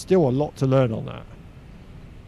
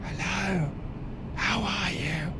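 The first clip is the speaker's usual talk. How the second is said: higher, softer mock cabin chatter; 5 semitones higher, 8 dB quieter, slower than usual. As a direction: pretend small talk in a hushed cabin. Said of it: "You could kind of talk like this".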